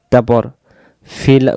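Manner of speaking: ordinary talk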